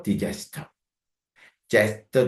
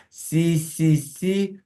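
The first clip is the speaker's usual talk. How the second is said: 씨 repeated much higher; high syllable drill; 7 semitones higher, much slower than usual. Shown as a demonstration. Teacher pitching the stressed 씨 high. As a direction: call 씨 high. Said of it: "강세가 두 번째 있거든요".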